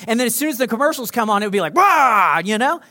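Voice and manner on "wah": loud, higher burst of "wah"; blaring mock yell. Commercials come on at full blast, blaring yell.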